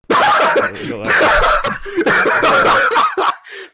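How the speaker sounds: loud, very high laughing fit; real shrieking laughter; explosive laughter. Real, not acted. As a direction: burst out laughing hard. Bursts out at absurd comment.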